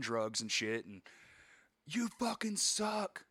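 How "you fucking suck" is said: high, drawn-out jeering; imitated hecklers. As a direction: jeer slowly from across the lot, pitched up. Rival band heckles from parking lot.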